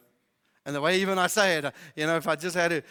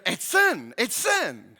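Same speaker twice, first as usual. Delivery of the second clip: ordinary delivery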